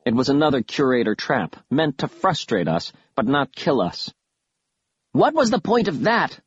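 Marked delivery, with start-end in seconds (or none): 5.1-6.4 high, indignant mild bellow. A narrator's reading. Kaz confronts Killiman over the trap, bellowing. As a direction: demand an answer, pitched way up. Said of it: "I heard Kaz bellow at Killiman".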